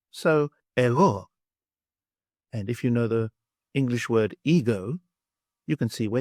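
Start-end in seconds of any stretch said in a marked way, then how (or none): 0.8-1.3 "ego" as e-GHO, stressed at the end; Greek pronunciation. Shown as a demonstration. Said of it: "I'll say it for you now".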